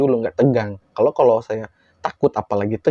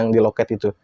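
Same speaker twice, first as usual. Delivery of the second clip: ordinary delivery, nothing marked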